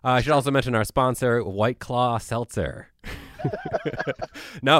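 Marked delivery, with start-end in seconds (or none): 3.0-4.6 bursting into high laughter; big laugh. Real, not acted. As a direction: cracks up at his own joke. Jokes about a fake beer sponsor.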